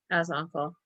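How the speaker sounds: usual speaking voice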